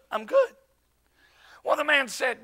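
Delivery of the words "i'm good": high, rising mock-cheerful reply; acted falsely breezy little answer; evangelist's stock answer. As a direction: pipe it up high as if all is fine. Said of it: "we're just gonna say".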